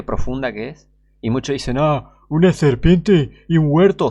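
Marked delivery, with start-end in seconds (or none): 1.8-4.1 slow, loud mock voice of a scoffer; mocking skeptic. Preacher mocking scoffers, slow loud sneering voice.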